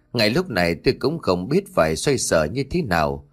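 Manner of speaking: plain reading voice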